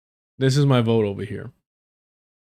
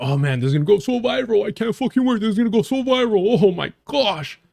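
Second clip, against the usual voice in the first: higher, gushing fake glee; sarcastic gushing, pitched up high; mock giddy excitement; 7 semitones higher than usual. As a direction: ham up a giddy politician picturing a viral clip. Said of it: "He's like".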